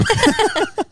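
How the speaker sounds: high-pitched burst of laughter. Real, not acted. Laughing at joke.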